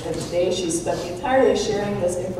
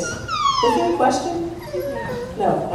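surprised high question; slower than usual. Real, not acted. Surprised at a raised hand.

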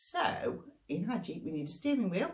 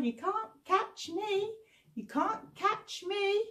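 teasing monkeys; 12 semitones higher than usual. Acted. Monkeys teasing Mister Crocodile, sing-song taunt. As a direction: tease like a cheeky monkey.

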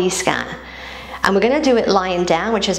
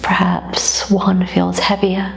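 calm, even meditation voice with long pauses; guided body scan; 4 dB quieter than usual. Acted. Yoga teacher guiding listeners through a body scan, calm meditative voice. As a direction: guide them gently through the body, leaving space between phrases.

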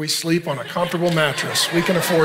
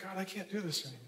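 weary despair; 19 dB quieter, faster than usual. Acted. Elijah exhausted, giving up.

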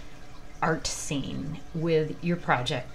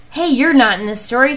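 indignant hen; 7 semitones higher, 12 dB louder than usual. Acted. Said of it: "said Chicken Licken".

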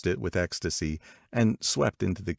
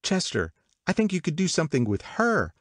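high, sudden outburst to a husband; breaking out; 12 semitones higher than usual. A narrator's reading. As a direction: burst in with a bright idea, pitched up. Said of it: "she broke out".

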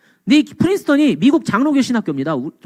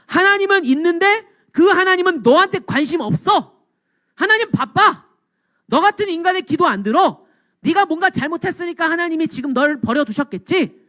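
high, emphatic jeering; scornful dismissal, pitched well up; mocking taunt; 8 semitones higher than usual. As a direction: imitate scoffers putting down someone's faith. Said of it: "조롱하는 거예요".

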